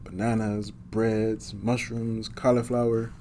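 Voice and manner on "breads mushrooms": usual speaking voice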